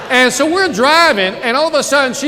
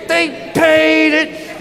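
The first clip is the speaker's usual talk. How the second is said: slow triumphant reveal; 5 semitones higher, much slower than usual. Acted. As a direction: stretch it out like a victory. Repeated punchline reveal.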